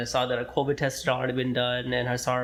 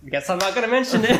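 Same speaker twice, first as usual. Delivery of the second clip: higher, louder, half-laughed confession; laughing sheepishly; 9 semitones higher, 7 dB louder than usual. Retold or imitated. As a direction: giggle through the sheepish aside. Sheepish retold thought, laughing.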